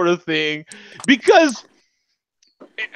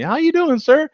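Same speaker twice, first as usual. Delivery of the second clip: high, bright service voice; chirpy flight attendant greeting; 12 semitones higher, 4 dB louder than usual. Retold or imitated. Flight attendant greets a boarding passenger, high and chirpy. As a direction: play the cheery stewardess at the door. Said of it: "And she asked me".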